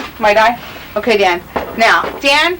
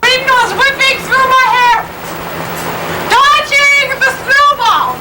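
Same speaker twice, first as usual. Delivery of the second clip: slow dramatic; 5 semitones higher, much slower than usual. Acted.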